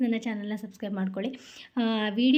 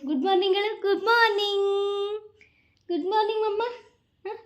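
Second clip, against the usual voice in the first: much higher, sing-song coaxing; 9 semitones higher than usual. Real, not acted. Mother coaxing her crying daughter to say good morning, in high baby talk.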